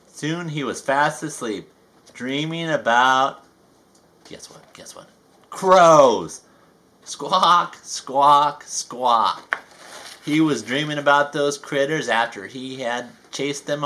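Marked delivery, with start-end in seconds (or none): 4.2-5.1 hushed teasing whisper; whispered guess what. Acted. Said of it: "teasing the listener". 5.5-6.4 sudden loud shout after a whisper; big punchy reveal. Acted. 7.0-9.6 loud falling cawing squawks; crow squawks. Acted. Crows squawking in dream.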